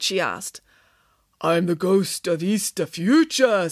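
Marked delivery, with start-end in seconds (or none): 1.4-3.7 slow, drawn-out spooky ghost; ghostly voice. A narrator's reading. Derek playing ghost of Easter future, slow ghostly voice. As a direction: put on a ghost, stretching the words. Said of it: "throwing on a ghostly".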